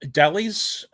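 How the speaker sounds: Dahlias said "DAL-eez", short flat A; short-A pronunciation. Shown as a demonstration. Streamer unsure of a nursery's name, Dahlias as "DAL-eez". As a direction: say Dahlias with the A of "dally". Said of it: "which way you'd pronounce it".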